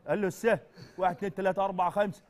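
loud, high cries for dad and mom; loud childish calls for father and mother; childish calling out. Acted. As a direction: call for your parents like a lost child.

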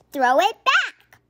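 loud, high, slow demand; yell. A narrator's reading. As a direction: shout for the ball back. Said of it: "yelled the other players".

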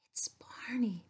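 breathy excited whisper. Acted. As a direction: whisper the name in awe. Excited secret reveal.

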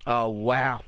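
ordinary talk

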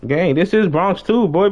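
ordinary delivery

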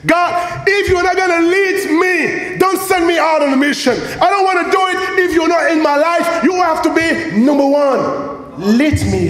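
shouted prayer of Moses; impassioned plea. Acted. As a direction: beg God not to send you alone. Preacher voicing Moses' plea.

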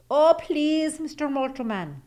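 high, loud begging; pleading leprechaun. A narrator's reading. Leprechaun pleading with his captor, high and loud. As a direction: beg like a caught little man. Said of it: "he pleaded".

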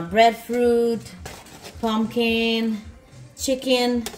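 usual speaking voice

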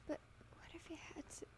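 fast, breathless whispered fear; whispering. Acted. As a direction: whisper it in dread. Tense inner thought in story.

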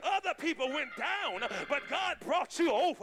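high, loud, rhythmic pulpit climax; whooping preacher rousing the congregation; full-force preaching. Real, not acted. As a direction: build phrase on phrase to a shouted peak. Sermon climax.

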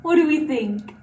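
normal talking voice

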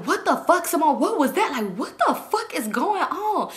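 high-pitched mock disbelief; shocked classmates. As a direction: imitate people reacting in shock. Classmates' shocked reaction acted out.

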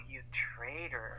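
the dog smells the cat and accuses; low, slow grumble of betrayal; accusing dog. Retold or imitated.

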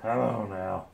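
ordinary delivery

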